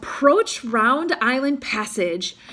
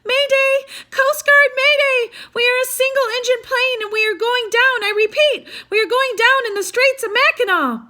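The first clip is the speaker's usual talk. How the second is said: high, loud, frantic mayday call; scared radio voice; 13 semitones higher, 6 dB louder than usual. A narrator's reading. Pilot radioing the Coast Guard as the plane goes down, scared and frantic. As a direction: panic over the radio from a falling plane. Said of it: "A scared voice came over the radio".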